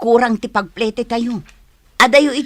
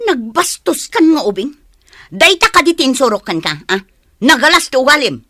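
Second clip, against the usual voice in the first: scolding; 3 semitones higher, 3 dB louder than usual. Acted. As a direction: reprimand a rude child sharply. Mother scolds child for rudeness. (makaunget nga ina)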